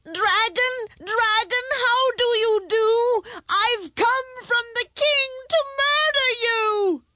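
high, loud trembling cry; loud and firm. A narrator's reading. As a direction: cry it out firmly but scared. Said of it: "As loudly and firmly as he could manage".

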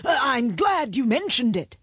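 reply as a startled king; high-pitched king voice; flustered king. A narrator's reading.

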